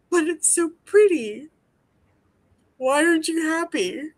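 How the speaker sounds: high, airy mock of her mother; mocking mom. Mother brushing off her dying daughter, mock lilt. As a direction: mimic a clueless mother, lilting. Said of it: "my mother's just like".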